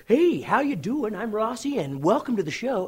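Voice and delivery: high, swooping, drawn-out fake host greeting; unnatural auditioning actor. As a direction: overdo a cheesy show welcome, imitating a stiff auditioner. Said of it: "actors just cannot be natural".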